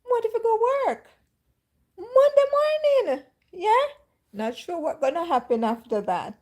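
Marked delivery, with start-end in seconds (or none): 0.0-3.8 high, loud, fast indignant sass; sassy retort. Retold or imitated. To the Holy Spirit asking for her money, high indignant sass. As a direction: talk back high and indignant.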